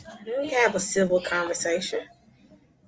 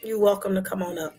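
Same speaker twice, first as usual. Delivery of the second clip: ordinary talk, nothing marked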